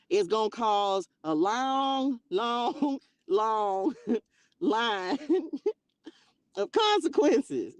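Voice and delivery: slow, drawn-out long through laughter; slow giggling warning; laughing warning. Real, not acted. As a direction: stretch long while laughing.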